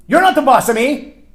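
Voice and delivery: put-on high, loud childish retort; loud mock-bratty comeback; defiant child. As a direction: throw it like a bratty kid. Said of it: "Let me translate that for you".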